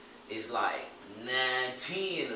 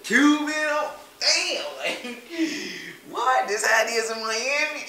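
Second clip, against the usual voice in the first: high, loud, laughing amazement; amazed; 10 semitones higher, 7 dB louder, slower than usual. Retold or imitated. Reliving seeing Miami women, high excited laughing. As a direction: blown away, laughing in disbelief.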